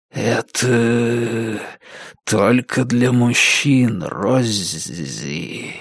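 slow, eerie drawn-out whisper; hushed ghostly voice, lingering on each word; whispering temple. A narrator's reading. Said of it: "прошептал храм".

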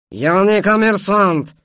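slow, high, loud protest; indignant shout. A narrator's reading. Ashot rejects Khmel's jibe, shouting indignantly. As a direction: cry out in outrage. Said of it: "закричал он".

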